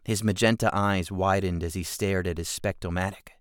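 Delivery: ordinary delivery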